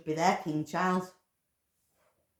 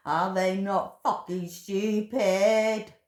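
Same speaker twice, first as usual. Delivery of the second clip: slow angry question; 5 semitones higher, 8 dB louder, much slower than usual. Real, not acted. Raging at abattoir workers.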